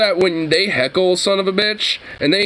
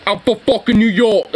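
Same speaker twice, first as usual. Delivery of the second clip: thick New York; 5 semitones higher, 3 dB louder than usual. Retold or imitated. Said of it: "that more thick, like, New York".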